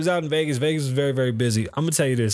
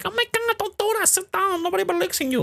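mock yelling. Imitating the corny host yelling at the girls, high mock yell. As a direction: squeal it up high, mocking her. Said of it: "She started yelling. She's like".

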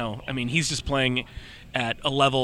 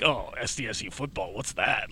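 dismissive, softer mock-arrogant sneer; mock cocky arrogance; 3 semitones lower, 6 dB quieter than usual. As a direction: brush it off like a cocky kid, a bit quieter. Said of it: "a cocky, arrogant sort of like".

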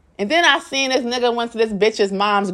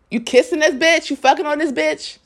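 higher-pitched mock accusation; retold confrontation; 6 semitones higher than usual. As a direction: re-enact a jealous challenge, pitched up. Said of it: "Now we're fighting all the time".

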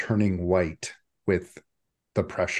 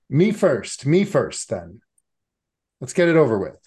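loud, higher cry of me first, then a quick drop on then; eager Cap; 5 semitones higher, 7 dB louder than usual. Acted. Cap volunteering first before the group, loud and eager. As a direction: jump in, wanting to get it done. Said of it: "I can't do Caps's voice".

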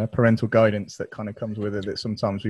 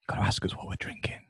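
hushed voiceless prompt; very soft stage whisper; 2 semitones lower, 11 dB quieter than usual. Real, not acted. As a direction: whisper a cue to the host. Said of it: "Whisper that".

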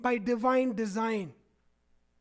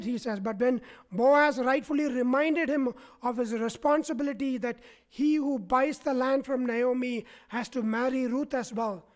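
usual speaking voice

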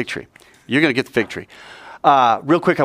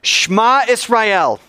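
high, drawn-out ringing call; with gusto; 9 semitones higher, slower than usual. Shown as a demonstration. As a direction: lead the congregation in a full-voiced prayer call. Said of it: "say it with some gusto".

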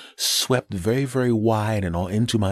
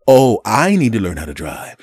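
louder, incredulous echo; sarcastic comeback; mock disbelief; 3 semitones higher, 7 dB louder than usual. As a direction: throw the insult back in disbelief. Mocking the driver's retort back.

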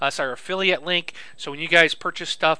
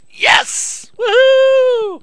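high, loud whoop; excited cheer; 12 dB louder, much slower than usual. Real, not acted. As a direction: cheer in real triumph. Audio levels confirmed good.

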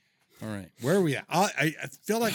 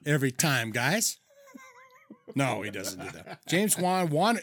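ordinary talk, nothing marked

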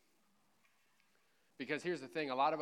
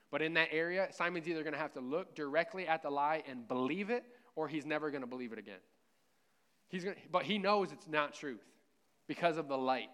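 louder preaching, pushing hard on never; raised pulpit voice, lifting on never; 2 semitones higher, 4 dB louder than usual. Real, not acted. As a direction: preach it at full force to the congregation. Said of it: "Man, I'm really preaching really well".

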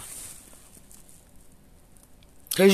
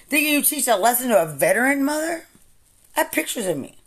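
higher voice, outraged; indignant outburst; 8 semitones higher, faster than usual. Real, not acted. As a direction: protest with wounded pride. Real rant, indignant outburst.

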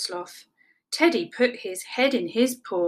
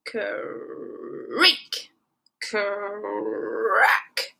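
slow rolled creak and crack; drawn-out croaking creaks; creaking tree imitation. A narrator's reading. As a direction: stretch the r like splitting wood.

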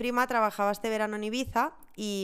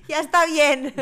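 laughing; 6 semitones higher, 13 dB louder, slower than usual. Real, not acted. Joking complaint about ghosting.

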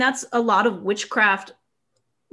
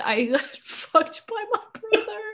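much higher, half-laughed despair; mock anguish, laughing; 11 semitones higher, 4 dB quieter than usual. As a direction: fake a wail but crack up. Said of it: "She's like".

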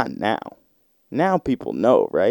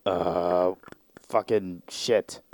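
resigned groan; 3 semitones lower, 8 dB quieter, much slower than usual. Retold or imitated. Dude resigned to demand.

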